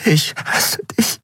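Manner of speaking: slow whisper breaking into crying; tearful whisper. A narrator's reading. Endriel to Kero before she weeps, tearful whisper. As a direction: whisper it haltingly, close to weeping. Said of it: "Dann begann sie zu weinen".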